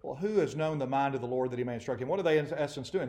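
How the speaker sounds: usual speaking voice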